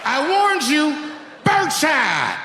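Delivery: high shouted warning as he fires; hammed-up battle cry. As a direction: yell it like a cartoon gunman. Firing shotgun in story.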